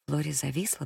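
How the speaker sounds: usual speaking voice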